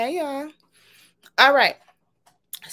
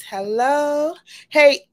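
ordinary delivery

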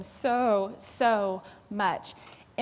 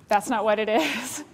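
ordinary talk

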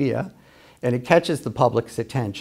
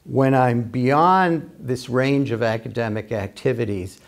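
ordinary talk

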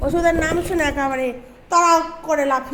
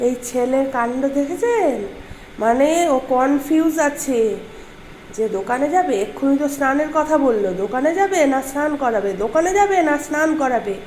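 ordinary talk, nothing marked

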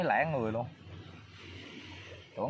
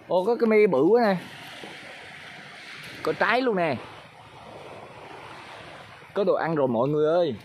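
excited calling out; 7 semitones higher, 6 dB louder than usual. Real, not acted. Finds tamarind after long search.

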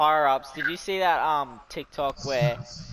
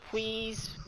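cutesy voice; 9 semitones higher, 8 dB quieter than usual. Retold or imitated. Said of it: "They said it, like, in a weird, dumb way".

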